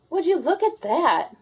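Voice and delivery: high amazed exclamation; exclaiming. A narrator's reading. Gwen coming out of the restroom, high amazed exclaim. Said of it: "she exclaimed".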